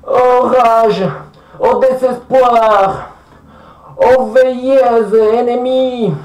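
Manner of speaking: slow, drawn-out tragic declamation; mock declamation. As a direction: declaim it grandly like old verse tragedy. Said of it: "qui se met à déclamer".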